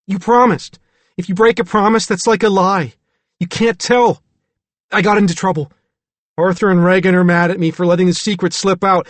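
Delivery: put-on high loud pleading child voice; a small boy insisting the promise matters; frightened little boy. A narrator's reading. David keeps pleading.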